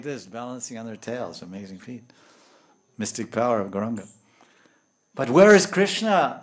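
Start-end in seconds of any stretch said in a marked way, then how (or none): 5.1-6.4 louder, higher urgent question; acted pleading outcry; searching cry. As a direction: call out in search of the Lord. Acts out searching for Krishna.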